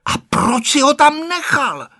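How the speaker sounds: high-pitched accusing question; stern questioning. A narrator's reading. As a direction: interrogate him in a high, insistent voice.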